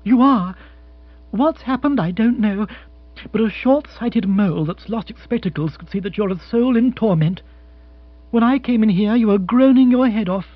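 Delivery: woman refusing to be put off; insistent fiancée. Acted. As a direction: press him, indignant and concerned. Narrator voices Jill insisting.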